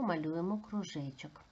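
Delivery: ordinary talk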